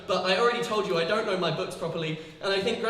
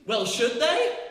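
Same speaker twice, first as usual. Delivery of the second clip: normal talking voice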